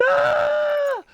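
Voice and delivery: a loud shrieking scream of real frustration; short shriek of annoyance; frustrated scream. Real, not acted. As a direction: let out a high yell as everything breaks. Stream broke, frustrated scream.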